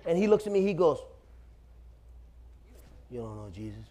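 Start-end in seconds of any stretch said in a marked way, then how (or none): 3.1-3.8 low, hushed retold challenge; quiet challenge. Stranger challenges the young man's faith, quiet challenge.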